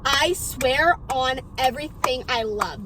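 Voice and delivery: slow, weighted, word-by-word vow; solemn retold oath. Best friend vowing she is not lying, slow solemn oath. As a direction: swear it slowly and emphatically, imitating her. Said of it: "this is how she said it".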